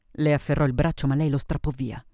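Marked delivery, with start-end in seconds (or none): none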